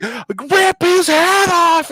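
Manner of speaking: high, slow, drawn-out cry; bloodthirsty fan. Retold or imitated. Wife shouting at the TV fight, high drawn-out cry. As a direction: savor every word like a fan at ringside. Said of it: "Dakisa's like".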